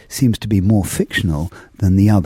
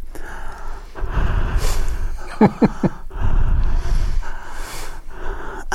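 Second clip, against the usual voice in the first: long, slow breaths close in the ear; close-up breath blowing; breathing into the microphone; 13 semitones higher than usual. Shown as a demonstration. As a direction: exhale right into the mic. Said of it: "breathe into this microphone".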